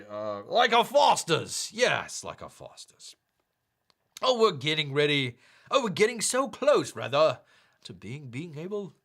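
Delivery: bad Australian accent. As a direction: fumble along in a cartoon Australian accent. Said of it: "I gotta do this in the accent".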